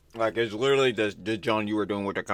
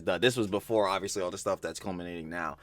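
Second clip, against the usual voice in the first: normal talking voice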